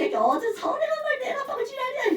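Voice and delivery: high, rushed mock rant; fast, high-pitched scolding in a put-on voice; mock angry scolding. Teacher imitating someone lashing out at a student's wrong reading, mock angry. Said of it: "수면 차이 맞을 수도 있어".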